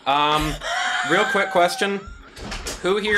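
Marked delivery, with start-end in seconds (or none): 1.1-3.2 excited. Real, not acted. Excited interruption.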